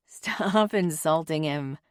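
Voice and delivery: slow, amused, laughing through it; laughing at her friend's insults. A narrator's reading. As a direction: chide while laughing. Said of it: "she says, laughing".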